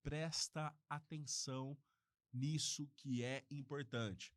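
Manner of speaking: "presta atenção nisso que é importante" slowed right down, each word weighted; slow emphasis. Shown as a demonstration. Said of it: "Aí você vai dar destaque".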